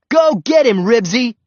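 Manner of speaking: high, loud egging-on yell; cheering on. A narrator's reading. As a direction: holler the dog into the fight. Boy cheers on fighting dog.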